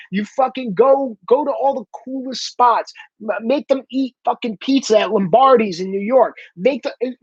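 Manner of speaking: ordinary delivery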